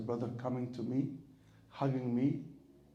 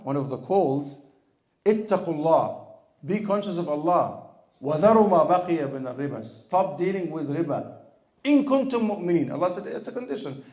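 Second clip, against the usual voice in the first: full-force preaching; 3 semitones higher, 9 dB louder, much faster than usual. Real, not acted. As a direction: thunder out a scriptural warning, verse then translation. Preaches a Quranic warning at full force.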